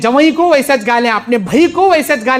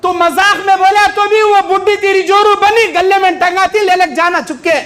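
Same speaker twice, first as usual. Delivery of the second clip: usual speaking voice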